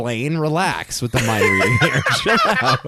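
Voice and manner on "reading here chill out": laughing teasing protest. Real, not acted. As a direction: laugh while telling him to chill. Teasing friend's guess.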